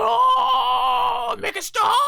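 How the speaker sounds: very high, loud whiny wail; mock pained plea. Fan voicing Goma's face as he's pummeled, a high whiny wail. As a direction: ham up a beaten villain begging. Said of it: "look at that face going".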